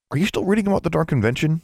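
high, quick demanding question; impatient interrogation. A narrator's reading. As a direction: press for an answer. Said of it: "he demanded".

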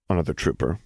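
plain reading voice

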